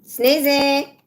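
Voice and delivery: high, loud call across the house; calling the cat. Real, not acted. Owner calling her cat from another room, loud call. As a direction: call out the cat's name loudly. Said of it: "I'm calling my cat".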